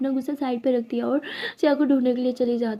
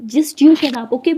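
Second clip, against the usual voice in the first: angry shout; 8 dB louder, much slower than usual. A narrator's reading. Heated quarrel, throwing words back.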